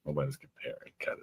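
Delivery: breathy whisper, barely voiced. Real, not acted. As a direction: whisper it, shyly brushing off praise. Modest denial after voice compliments.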